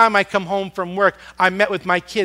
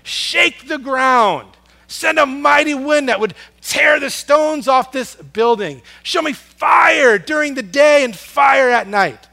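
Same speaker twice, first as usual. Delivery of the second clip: loud, higher, slow desperate cry; yelling to God; 7 semitones higher, 5 dB louder than usual. Retold or imitated. Desperate believer yelling to God for a sign, loud. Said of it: "we just yell to God".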